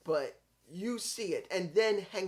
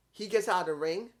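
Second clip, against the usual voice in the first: usual speaking voice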